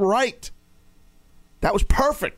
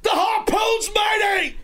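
pirate shout; slower than usual. Pretend. Pirate joke with co-host.

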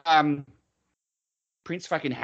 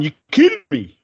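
louder, incredulous mock yell; mock outburst; 8 dB louder than usual. Retelling a player losing it, mock yell. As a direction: fling it out in disbelief. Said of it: "He's gone".

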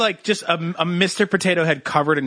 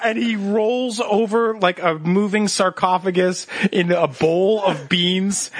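ordinary talk, nothing marked

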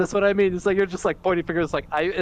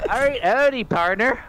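bad southern accent; 7 semitones higher than usual. Friends trading accent attempts, clumsy southern accent. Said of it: "Canadian trying to do a southern accent".

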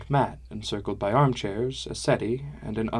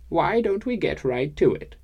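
put-on old woman voice; 7 semitones higher than usual. Old woman Gilly speaks.

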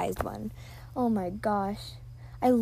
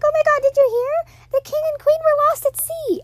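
much higher and louder, excited gossip; gossiping townspeople; 8 dB louder than usual. Retold or imitated. Townsperson in the musical gasps out the news, high and loud. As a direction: shout the news like a shocked townsperson. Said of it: "they're like".

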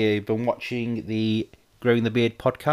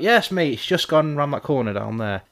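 lightly voiced helpful detectorist; imitating a man giving directions; acted joke reply; 7 semitones higher, 4 dB louder than usual. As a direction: raise the pitch to play the obliging reply. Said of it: "He's like".

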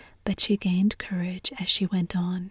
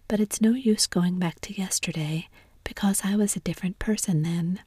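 ordinary delivery

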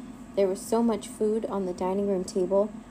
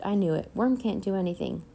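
plain reading voice

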